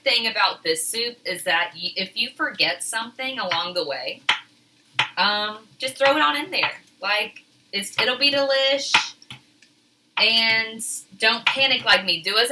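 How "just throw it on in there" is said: ordinary talk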